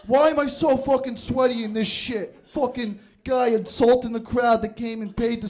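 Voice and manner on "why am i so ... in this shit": usual speaking voice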